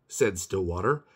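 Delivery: usual speaking voice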